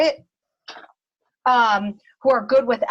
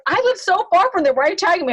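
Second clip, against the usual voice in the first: mock whiny friend; 7 semitones higher, 3 dB louder, faster than usual. Friend Angel quoted complaining.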